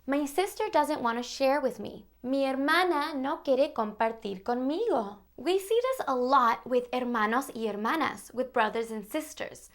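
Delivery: ordinary talk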